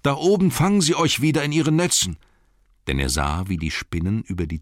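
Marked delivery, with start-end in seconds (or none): 0.0-2.2 high, raised calling voice; shouted warning. A narrator's reading. Bilbo warning the dwarves up in the tree about the nets, high shout. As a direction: call a warning out high and urgent. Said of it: "rief er den Zwergen zu".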